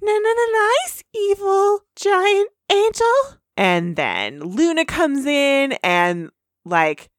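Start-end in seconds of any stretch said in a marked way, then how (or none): 0.0-3.4 high, slow, soothing coo; Fluttershy voice. Acted. Podcaster voicing Fluttershy calming the evil bunny, high and slow. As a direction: coax the giant bunny gently.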